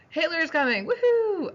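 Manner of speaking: high, loud crowd-style cheer; excited imitation of a welcoming crowd; mock cheering. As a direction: whoop it up in mock celebration. Acting out cheering Austrian crowds.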